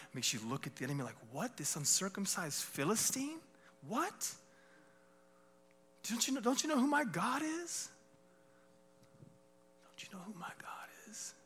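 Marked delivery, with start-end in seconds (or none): 1.3-4.3 scoffing disbelief, rising sharply on each 'What?'; incredulous challenge. Acted. Acted scornful reply to the enemy. 6.1-7.9 high-pitched, disbelieving challenge; incredulous challenge. Acted. Acted defiant challenge to enemy. 9.8-11.3 near-whispered, disbelieving question; hushed challenge. Acted. Challenge repeated in a hush.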